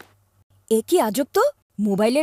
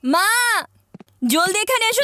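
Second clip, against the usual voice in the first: raised, higher-pitched call; calling out loudly; 5 semitones higher than usual. Acted. Boy summons his mother to come quickly, calling out. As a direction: call out urgently for someone to come. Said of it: "এত চিৎকার করছো কেন".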